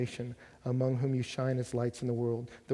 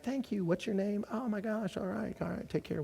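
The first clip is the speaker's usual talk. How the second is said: higher, sugary mock-polite thanks; overboard sweet; 7 semitones higher, 4 dB quieter than usual. Retelling friends being overly sweet to a waitress, mock sugary. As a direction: pour on the sweetness for the struggling waitress. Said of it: "let's just make sure we're overboard sweet to her".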